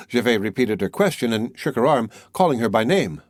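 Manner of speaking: ordinary delivery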